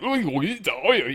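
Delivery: high mock Scottish calls of oi; lightly put-on Scottish shouting oi oi. Mocking a Scottish accent, a high lightly put-on Scottish oi. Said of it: "that certain part of Scotland".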